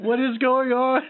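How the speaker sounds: high, slow, rising confusion; bewildered. Acted. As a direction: wail it in mock bewilderment. Confused character in swapped body.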